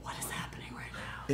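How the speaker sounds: whispering. Retold or imitated. Whispering while watching a scene.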